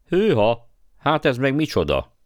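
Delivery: high, loud cry of astonishment; surprised exclamation. A narrator's reading. Boy spotting something strange, crying out in surprise. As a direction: exclaim in sudden amazement, pitched well up. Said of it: "Kiáltott fel Digori".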